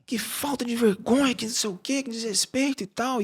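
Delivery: high-pitched mock outrage; irate husband. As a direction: imitate a furious man's indignant rant. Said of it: "o cara chegou assim, ele bateu".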